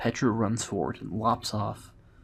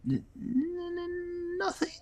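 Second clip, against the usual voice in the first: very high squeaky stutter; caught-out little creature, stuck on the N; stammering kobold; slower than usual. Acted. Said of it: "stammered the kobold".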